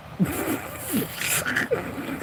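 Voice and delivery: high airless burst of laughter; breathless laugh. Real, not acted. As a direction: break up laughing without voice. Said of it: "I was laughing so hard".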